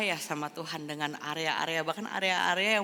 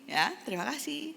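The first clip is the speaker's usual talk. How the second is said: polite thanks; 7 semitones higher, 3 dB quieter than usual. Acted. Tuhan kan gentleman.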